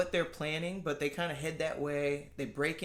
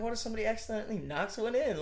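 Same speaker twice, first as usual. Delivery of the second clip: fast, higher worried voice; 6 semitones higher, faster than usual. Retold or imitated. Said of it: "I'm like".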